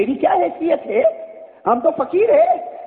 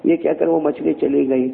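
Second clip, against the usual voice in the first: ordinary talk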